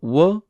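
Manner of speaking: "wa" said as a reduced wuh; "wa" warped to wuh, set apart in the sentence; non-Japanese vowel. Shown as a demonstration. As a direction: give "wa" a dull English uh vowel. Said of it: "is not a Japanese sound at all".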